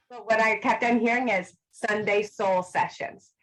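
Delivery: normal talking voice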